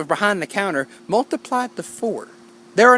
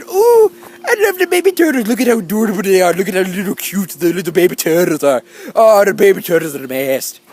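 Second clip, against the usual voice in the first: put-on accidental British accent; 4 dB louder than usual. Swimmer gushing over baby turtles on the beach, accidental British accent. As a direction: coo in a hammed-up British accent, words tumbling out. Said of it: "I did my British accent by accident when I was talking like that".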